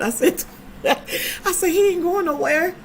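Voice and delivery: high, slow words broken by laughter; laughing. Real, not acted. Telling friends about her husband, laughing high and slow. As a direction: laugh through the retold line.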